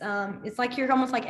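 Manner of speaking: ordinary delivery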